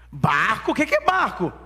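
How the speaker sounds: loud echo, then high-pitched puzzled question; hammed-up kid who has never heard the word; baffled boy. Boys hearing Sem mention a boat, incredulous.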